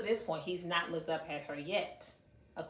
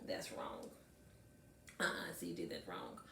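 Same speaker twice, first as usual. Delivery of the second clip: hushed correction; 13 semitones lower, 9 dB quieter than usual. Retold or imitated. Checking kid's homework, whispered.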